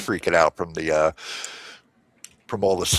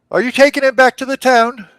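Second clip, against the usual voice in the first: high, bright question; gnome voice; 6 dB louder than usual. Acted. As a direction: chirp it cheerfully. Gnome voice asks.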